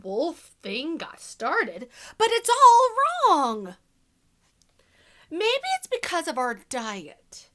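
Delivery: sly wolf narrator, hushed then punchy; storybook reading. A narrator's reading. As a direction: tell it to children as the wolf, confiding and playful. Reads the wolf's story to children.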